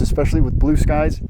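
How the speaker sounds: usual speaking voice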